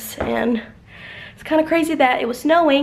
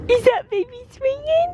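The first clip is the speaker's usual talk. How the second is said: much higher sing-song coo; 11 semitones higher than usual. Real, not acted. Higher playful repeat.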